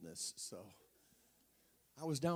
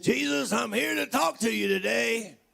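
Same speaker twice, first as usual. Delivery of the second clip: imitated voice; 9 semitones higher, 12 dB louder than usual. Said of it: "I'm gonna imitate his voice to God".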